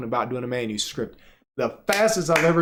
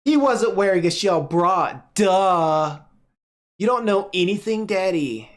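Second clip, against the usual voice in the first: put-on high child voice with know-it-all sass; sassy little girl; 5 semitones higher, 3 dB louder than usual. Clara mocks her daddy for not knowing merfolk, sassy high child. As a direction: roll the eyes like a smug kid.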